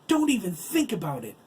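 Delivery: high, loud command; fierce scolding. Acted. Wife scolds husband after slapping him.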